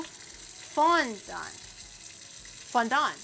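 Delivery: "Fondant" as FON-dawn, final t silent; first-syllable emphasis. Shown as a demonstration. Streamer testing where the emphasis goes, stressed first syllable of "Fondant". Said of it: "They put emphasis on the first, uh, syllable".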